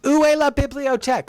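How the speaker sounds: high, bad English-speaker French; hammed-up schoolbook French; mock French. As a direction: ask for the library like a clumsy tourist.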